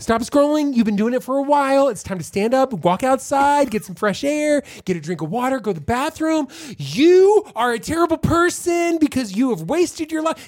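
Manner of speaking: high whiny nagging voice, a bit quick. Retold or imitated. Talking back to TikTok's nag in a high whine. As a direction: whine at the app like a nagging scold. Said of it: "Could you sound any more whiny".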